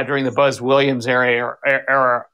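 normal talking voice

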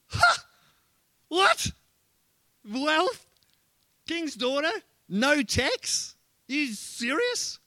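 high, rising questions in mock amazement; incredulous surprise. Preacher playing David hearing the reward, astonished and incredulous. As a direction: repeat each prize as a high, astonished question.

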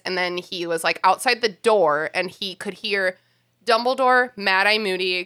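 ordinary talk

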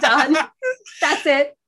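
loud, high, crying out; overjoyed yell, nearly in tears; excited shout. Real, not acted. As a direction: shout it like you've just been crowned. Thrilled at the new name.

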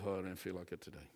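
fast, low, soft grumble; mock a reluctant grumbler; lightly voiced mumbled complaint; grumbling mutter. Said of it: "don't mutter to yourself".

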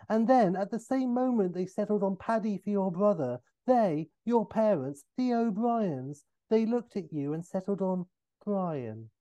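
high, laboured incredulous explanation; Austin Powers. Acted. Austin explaining the naming to O'Brien, incredulous. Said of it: "at Austin".

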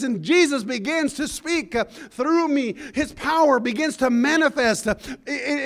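ordinary delivery

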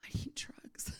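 barely-there breathless whisper; weak, out of breath. Retold or imitated. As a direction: act too spent to speak, just whisper. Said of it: "I'm barely speaking".

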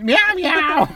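high mock kitten meow; child's cat meow. Little son who decided he's a cat, meowing high. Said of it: "He went".

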